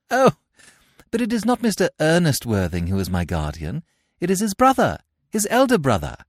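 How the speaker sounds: put-on a touch of girlish politeness; lightly voiced young girl. Cecily voiced in read play.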